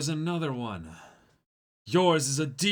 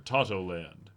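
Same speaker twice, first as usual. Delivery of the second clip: usual speaking voice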